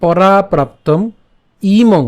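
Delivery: verse read again slowly, piece by piece; restart, chunk by chunk; careful teaching pace; slow read from the start. Shown as a demonstration. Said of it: "আমি আবার প্রথম থেকে বলি".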